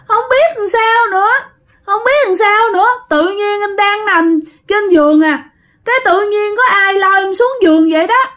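high, whiny tearful little brother; talking while crying. Acted. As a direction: whimper out the story like a child in tears. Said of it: "cậu Tư vừa nói vừa khóc như vậy". (vừa nói vừa mếu khóc, giọng cao như em nhỏ)

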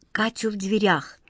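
plain reading voice

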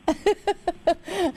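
high amused giggling; helpless amused chuckles; real laugh. Real, not acted. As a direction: burst into genuine high laughter. Amused caller asks about her husband.

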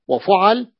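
وفعل as wa-fu'al, a after the 'ayn; open middle vowel. Shown as a demonstration. Teacher recites the second pattern, fu'al. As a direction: say وفعل with u then a. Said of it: "العين مفتوحة".

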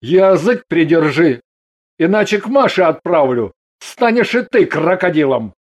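understated stern husband; mild bark. A narrator's reading. Husband threatens his wife with the crocodile fate, mildly barking. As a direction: warn her sternly, without real shouting. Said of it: "Ганин рявкнул".

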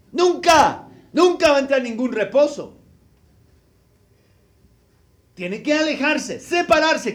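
loud, rising preacher's warning; preaching at full force. Acted. Preacher warning the congregation about sin, loud and fervent. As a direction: thunder the warning from the pulpit.

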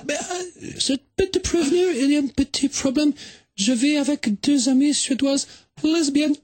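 put-on a visitor gently warning him, in a Swedish accent. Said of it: "mais quel joli accent!".

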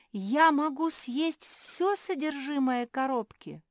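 high, loud, slow amazement; incredulous joy. A narrator's reading. Pug asking about her box of sweets, incredulously happy. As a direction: ask it as if too lucky to be true. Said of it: "не поверила своему счастью".

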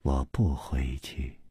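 low, hushed, deliberately separated words. A narrator's reading. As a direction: refuse quietly, one word at a time. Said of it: "一字一字的说".

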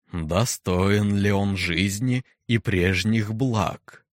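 slow, booming, impassive question; menacing deep voice. Acted. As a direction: let the words fall into silence. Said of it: "глубокий, низкий, бесстрастный".

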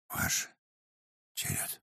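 faint, breathless murmur; barely audible. A narrator's reading. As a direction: hardly voice the words. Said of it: "еле слышно произнёс он".

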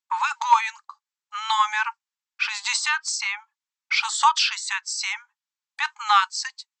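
cold, grinding electronic police robot; grating robot voice. Acted. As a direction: scrape out each word like a machine. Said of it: "Проскрежетал электронный голос робота".

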